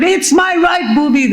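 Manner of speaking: patient on a trolley yelling her point down the corridor; frantic yelling. Retold or imitated. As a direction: yell it high and stretched like a panicked patient. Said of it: "I'm still at it".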